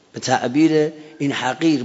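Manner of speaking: ordinary talk, nothing marked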